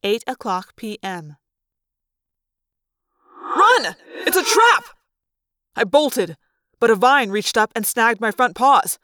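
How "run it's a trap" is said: urgent warning shout. A narrator's reading. Warning the others of a trap, urgent high shout. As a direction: yell it to warn the others.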